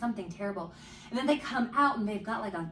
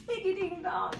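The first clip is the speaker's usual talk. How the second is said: laughing through the silly name; 11 semitones higher than usual. Real, not acted. Friend laughing at a silly store name, laughing. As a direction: giggle the name out, high and breathless.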